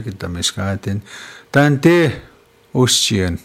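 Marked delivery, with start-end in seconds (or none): 1.5-3.4 Tante pitched high and loud, then Ostian falling; Cree pronunciation. Shown as a demonstration. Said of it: "teaching me three words in Cree".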